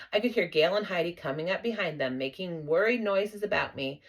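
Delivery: ordinary delivery, nothing marked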